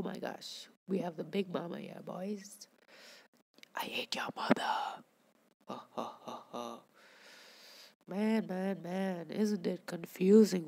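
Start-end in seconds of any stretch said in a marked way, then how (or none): 3.7-5.0 eerie whispered taunt; creepy villain whisper. Pretend. Gamer taunting a caught fish, creepy whisper. 5.7-6.8 low, soft mock villain laugh; evil laugh. Gamer gloating over a caught fish, low evil laugh.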